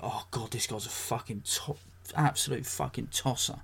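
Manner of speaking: mock disgusted aside; muttered disgust. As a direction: mutter it under your breath with disdain. Voicing what Brits think watching him.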